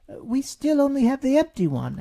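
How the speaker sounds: high, loud little beetle voice; timid beetle. A narrator's reading. Alexander Beetle reports to Rabbit, high and small. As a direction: report it nervously as a tiny beetle.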